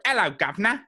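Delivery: high, mock Cockney greeting; lazy British accent. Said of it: "another lazy impression is when people try and do, like, a British accent".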